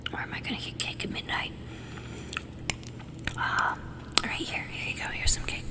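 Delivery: whispered ASMR with mouth sounds; soft close whisper, mouth sounds; tingly asmr mouth sounds. Real, not acted. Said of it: "I'm gonna do some mouth sounds".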